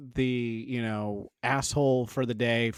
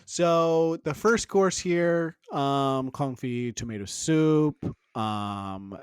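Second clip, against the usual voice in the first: ordinary talk, nothing marked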